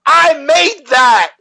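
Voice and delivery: high, slow proud boast; Will Smith impression. Will Smith bragging about his talented kid, high and slow. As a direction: brag like a proud dad showing off. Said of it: "Will Smith when he was like".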